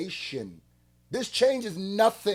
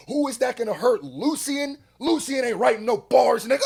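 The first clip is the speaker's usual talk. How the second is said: raised, higher and louder, arguing hard; heated rant; 7 semitones higher, 7 dB louder than usual. Real, not acted. Ranting at [name] about the lawsuit, loud and heated. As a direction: call him out loudly.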